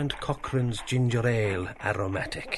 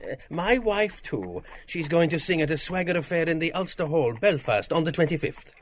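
higher, louder proud announcement; proud boast; 7 semitones higher, 5 dB louder than usual. Acted. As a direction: brag about the wife's concert. Bloom boasting about Molly's concert.

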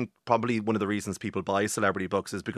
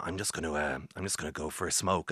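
much softer, lower, a touch choked up; subdued engineer; 6 semitones lower, 10 dB quieter than usual. Retold or imitated. Engineer Daniel on the intercom after a sad scene, softly subdued. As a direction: quietly excuse yourself, a little moved. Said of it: "came on the, the intercom and said".

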